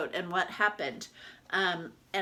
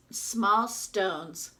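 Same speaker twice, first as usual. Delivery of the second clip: usual speaking voice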